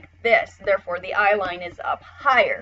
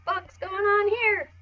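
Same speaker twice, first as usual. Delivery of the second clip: panicked character voice; 11 semitones higher than usual. Pretend. Drawn character panicking at the scene, high squeal. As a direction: voice the drawing's panic, pitched way up. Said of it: "the mouth is like".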